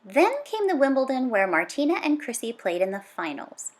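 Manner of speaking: usual speaking voice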